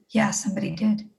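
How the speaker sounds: near-whispered, grave answer; hushed admission. Retold or imitated. Mother admitting a death to her upset daughter, hushed. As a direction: let it out barely voiced.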